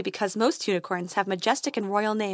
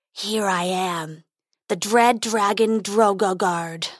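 growling dragon; slower than usual. Acted. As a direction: rumble it like a huge beast. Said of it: "growled the dragon".

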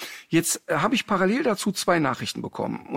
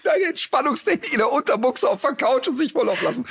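lachend; 9 semitones higher than usual. Real, not acted.

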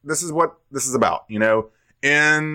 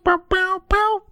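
high "bow bow" vocal riff. Retold or imitated. Mimicking jingle sound.

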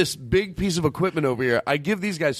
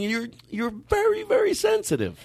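mock sensitive; 12 semitones higher than usual. Mocking sensitive fighters.

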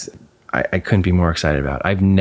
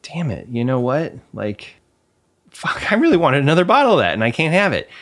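retold frustration; 8 semitones higher than usual. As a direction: vent the disappointment, half-mock. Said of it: "disappointed me was like".

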